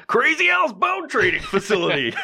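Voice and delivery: high laughing ad-man pitch; mock commercial announcer. Hosts joking about a bone business ad, mock announcer. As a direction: sell it like a cheesy TV spot.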